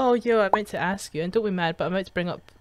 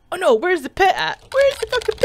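higher and louder, all amped up; excited yell; 9 semitones higher, 7 dB louder than usual. Acted. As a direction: shout it like you're hyped for the mosh pit. Playing game, amped up for pit.

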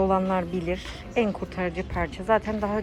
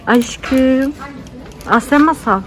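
delighted call; 4 semitones higher, 8 dB louder, slower than usual. Real, not acted. Greeting baby with delight.

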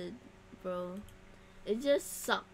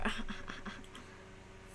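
breathy, voiceless chuckle; airy laugh; 6 dB quieter than usual. Real, not acted. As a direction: laugh it off softly. Laughs at voice changer idea.

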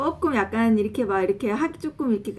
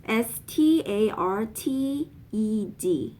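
spelled out slowly; much slower than usual. Shown as a demonstration. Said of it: "spelling out the word".

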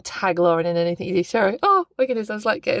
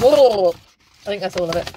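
ordinary talk

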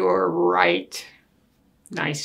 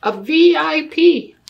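V I P spelled out higher and louder; stress on V I P; 10 semitones higher, 10 dB louder than usual. Acted. Child flatters Daddy as a V I P, punchy emphasis. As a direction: punch each letter of V I P.

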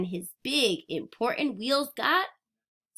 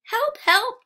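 much higher, louder cry for help; 12 semitones higher, 6 dB louder than usual. Acted. Little blue truck stuck in mud, high frantic cry. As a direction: call out desperately like a stuck truck. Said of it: "cried little blue truck".

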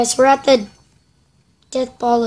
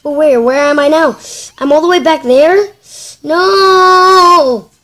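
distressed yelling; 4 semitones higher, 6 dB louder, slower than usual. Real, not acted. Upset about losing progress.

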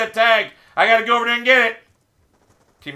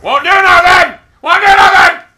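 high, loud yell of frustration; angry shout; 7 semitones higher, 9 dB louder than usual. Real, not acted. Gamer whose shots won't do nothing, angry shout. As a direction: shout it twice in real exasperation.

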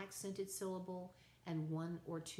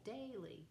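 daily, DAI raised high, then falling; stress on first syllable; 4 semitones higher, 4 dB quieter than usual. Shown as a demonstration. Teacher repeating the trochaic example, daily stressed on its first syllable. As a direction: lift the first syllable of daily, drop the second. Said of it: "the first syllable".

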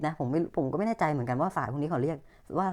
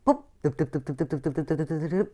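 vocal popping sounds. Pretend. Mouth sound while cards open.

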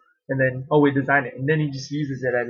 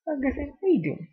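much higher, soft cooing to the dog; 11 semitones higher, 5 dB quieter than usual. Real, not acted. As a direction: coo at your puppy. Baby talk to her dog.

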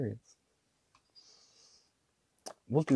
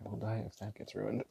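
usual speaking voice

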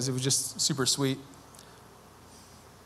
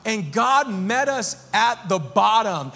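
rising sermon passion; 7 semitones higher than usual. Acted. Pastor to his congregation, preaching with rising fervor.

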